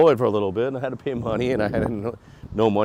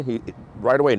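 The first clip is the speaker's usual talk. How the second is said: ordinary delivery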